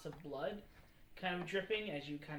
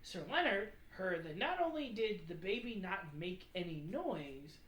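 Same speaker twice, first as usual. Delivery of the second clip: ordinary talk, nothing marked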